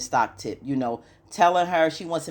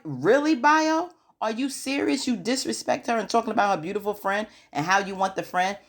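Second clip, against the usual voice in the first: pitched way up, calling Bayo out in disbelief; "really?!" scolding a man who crossed the line; indignant disbelief; 6 semitones higher than usual. Pretend.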